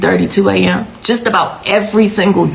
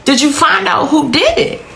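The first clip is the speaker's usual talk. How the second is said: urgent question; 8 semitones higher than usual. Retold or imitated. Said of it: "My exact next question to the officer is".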